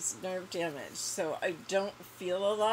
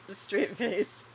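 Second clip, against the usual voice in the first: ordinary talk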